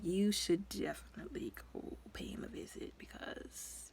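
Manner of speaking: hushed, whispered suggestion; whispered advice. Retold or imitated. Someone urging Catwoman to visit Batman, whispered. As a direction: whisper it like a sly tip. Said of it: "It was like".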